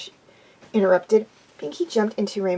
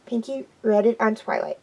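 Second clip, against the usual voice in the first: plain reading voice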